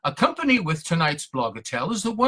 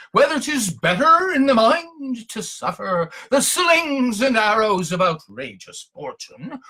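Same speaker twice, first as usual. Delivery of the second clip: lightly hammed-up Hamlet, higher; a hint of stage declamation on the soliloquy; Shakespearean actor; 8 semitones higher, slower than usual. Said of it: "feeling like a Shakespeare character".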